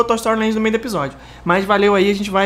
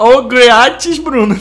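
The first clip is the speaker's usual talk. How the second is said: slow mocking; 4 dB louder, much slower than usual. Shown as a demonstration. Slow mocking reading of handle.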